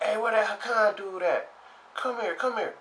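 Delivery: high, eager call. Retold or imitated. Crowd summons the Hakan player, high and eager. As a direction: call him over from across the room. Crowd calling him over.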